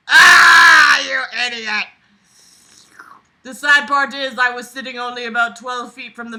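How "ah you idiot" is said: loud mock-furious shout; exasperated yell. Host yelling at the story's narrator in mock fury. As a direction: scream at him for being useless.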